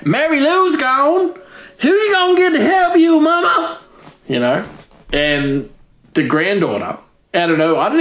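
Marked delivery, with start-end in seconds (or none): 0.0-3.8 much higher put-on voice, mock tearful plea; voice cracking. Daughter pleads with her hoarder mother, high mock cracking voice. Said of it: "she gets the crack".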